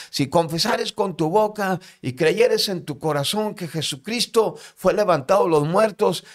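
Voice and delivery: high, loud preaching-style recital; sustained retold yell of a verse; shouted Bible verse. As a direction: belt out scripture as the shouting prisoner. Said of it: "dice, gritándolo".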